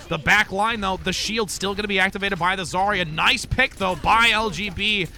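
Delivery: energetic esports commentary; play-by-play caster. Acted. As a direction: call the action like a live caster. Live play-by-play casting of match.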